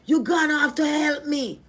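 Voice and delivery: high, slow, weary plea to God; pleading prayer. Retold or imitated. Retelling her prayer to God, a pleading cry. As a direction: beg God for help, worn out.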